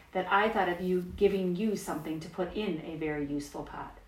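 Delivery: ordinary delivery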